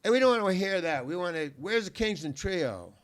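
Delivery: loud, high-pitched heckling; heckler's shout. Retold or imitated. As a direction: shout it from the back of a dark club. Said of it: "I hear this voice say".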